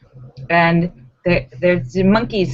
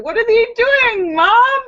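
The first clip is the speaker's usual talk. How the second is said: put-on high, curious kid asking mom; child's voice; 4 dB louder than usual. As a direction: pipe up like a little child. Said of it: "Awkward conversations with your children".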